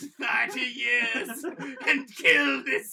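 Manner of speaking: slow, loud, high melodramatic command; hammy dying voice demanding revenge; overacted death scene. S2 continues the dying ghost's plea.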